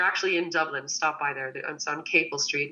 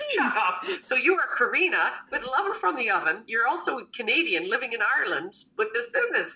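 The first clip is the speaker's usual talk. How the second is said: higher, excited retold disbelief; amazed shut up; 7 semitones higher than usual. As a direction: tell her it can't be true, delighted. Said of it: "I'm like".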